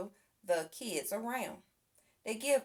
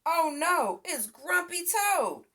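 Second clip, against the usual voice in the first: alarmed cry; 8 semitones higher, 4 dB louder than usual. A narrator's reading. Pete sees toad on roof.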